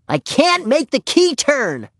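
put-on slow, very high little-girl complaint; frustrated child. A narrator's reading. Ramona cannot open the padlock for Henry, frustrated. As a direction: draw it out helplessly, high and childlike.

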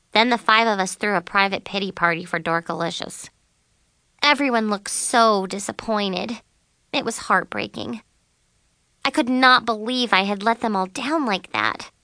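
ordinary delivery

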